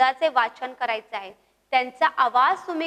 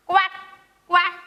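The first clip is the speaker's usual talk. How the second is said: duck quack; 4 semitones higher, much faster than usual. Shown as a demonstration. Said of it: "कसा?".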